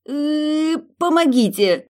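high, loud strained call; yanking the door, straining; straining with effort. Acted. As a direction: strain as you pull.

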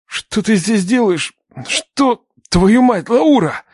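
high, distressed exclamation; shocked outburst. Acted. Man finds the girl he has shot, shocked outburst. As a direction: blurt it out in shock, pitch leaping.

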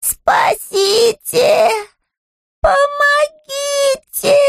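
high, slow desperate cries; crying for help. Acted. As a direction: wail for help at full voice. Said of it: "позвать на помощь... во весь голос".

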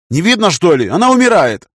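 loud, high frantic retort; exasperated, alarmed cry; desperate outburst. A narrator's reading. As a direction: snap back in panic, rising to a shout. Desperate snap beside dying woman.

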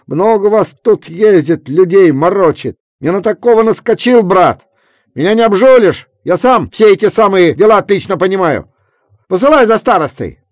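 high, loud blustering; angry bluster. A narrator's reading. Kuzma blusters at Efrem, loud angry bluster. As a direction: bluster at him.

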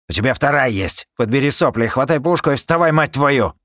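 loud, harsh berating; harsh scolding. Acted. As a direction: scold him up off the floor. Captain berates wounded crewman.

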